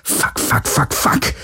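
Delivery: whispered string of curses; voiceless, insistent cursing; repeated inner thought. A narrator's reading. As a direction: keep swearing under your breath. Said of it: "dachte Galen in einem fort".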